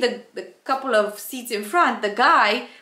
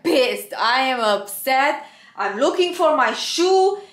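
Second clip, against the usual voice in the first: normal talking voice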